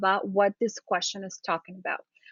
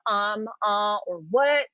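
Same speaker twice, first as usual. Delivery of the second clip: unsure filler; slower than usual. Shown as a demonstration. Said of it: "is a lot better than saying".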